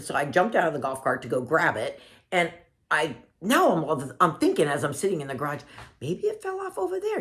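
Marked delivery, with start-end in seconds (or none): 6.0-7.2 much higher, hopeful sudden idea; light up with the idea; sudden realization. Retold or imitated. Crying woman in the garage suddenly remembers, high and hopeful.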